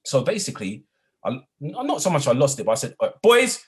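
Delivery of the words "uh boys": put-on high, loud call to the boys; raised voice. Teacher raising his voice at the boys in the corridor, loud. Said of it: "I raised my voice".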